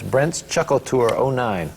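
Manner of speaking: usual speaking voice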